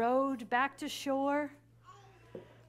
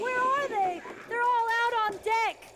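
higher, louder, anxious; frightened child; 8 semitones higher, 4 dB louder than usual. Acted. Child alone in the storm cabin, frightened. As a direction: let the panic rise in the voice.

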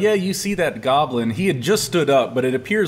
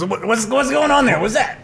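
goblin voice; 9 semitones higher than usual. Acted. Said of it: "He goes".

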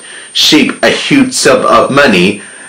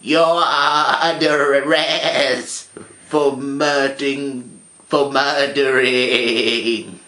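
sheep arresting; 3 semitones higher, 9 dB quieter, slower than usual. A narrator's reading. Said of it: "with a gun and said".

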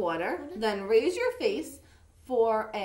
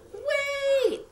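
high-pitched urgent call; acted yell, pitched way up; 4 dB louder than usual. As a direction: yell it to stop someone leaving. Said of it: "burst up for air and yelled".

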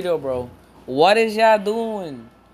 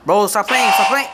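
yelling stop playing; loud exasperated yell; frustrated shout; 3 semitones higher, 5 dB louder than usual. Real, not acted. Frustrated at game event.

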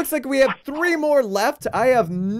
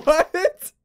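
ordinary talk

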